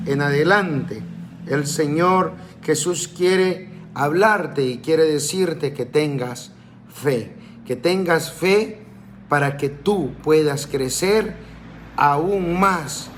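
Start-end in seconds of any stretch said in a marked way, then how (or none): none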